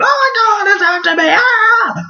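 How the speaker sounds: very high, squealed panicky shriek; mock panic. Runner chased by a tiger, mock terrified screaming. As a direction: scream as if fleeing for your life, hammed-up. Acts out person fleeing a tiger.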